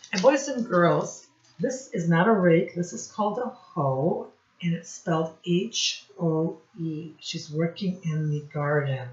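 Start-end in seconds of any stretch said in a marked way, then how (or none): none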